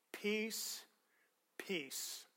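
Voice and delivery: Peace, peace said very softly, each word set apart; repeated for emphasis. Shown as a demonstration. Preacher softly stressing peace to the congregation. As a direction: let the doubled Peace, peace sink in gently. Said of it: "He is emphasizing the peace".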